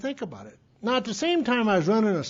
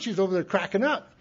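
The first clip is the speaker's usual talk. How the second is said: ordinary talk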